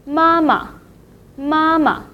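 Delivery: Mama with a short, light second syllable; high first syllable, then Mama drops to a light ending; neutral-tone demo. Shown as a demonstration. Teacher models the word for mother, neutral second syllable. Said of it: "second syllable will be repeated and marked in a neutral".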